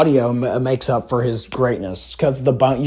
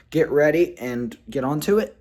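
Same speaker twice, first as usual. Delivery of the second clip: normal talking voice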